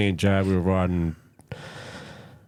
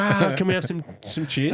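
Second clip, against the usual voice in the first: imitating the crowd; 10 semitones higher than usual. Crowd calling for cheers.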